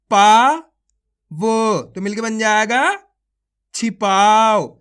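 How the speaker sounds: stretched-out syllable calling, each syllable punched; phonics drill. Shown as a demonstration. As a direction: sound out each syllable slowly for children, then join them. Sounding out syllables into words.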